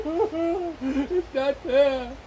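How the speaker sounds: slow, sobbing lament; crying. Acted. Rejected bee alone in the forest, sobbing. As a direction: weep it out, drawn out.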